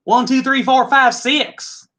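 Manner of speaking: a hammed-up Hoosier Southern twang on the numbers; Hoosier Southern accent. Whisky taster joking a bourbon count, light Hoosier Southern accent. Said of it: "A little bit of accent".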